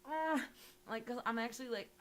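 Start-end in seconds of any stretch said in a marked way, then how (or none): 0.1-0.4 higher, louder mock dread at the horror casting; a playful oh-no cry; dreaded groan. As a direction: recoil in half-joking horror. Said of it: "And I'm like".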